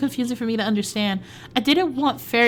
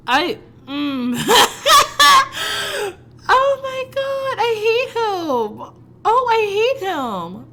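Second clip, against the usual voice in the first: high, slow, laughing groan; giggling swoon; 11 semitones higher, 5 dB louder, slower than usual. Real, not acted. Reader swooning over the boy she claims to hate. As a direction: melt into helpless giggles.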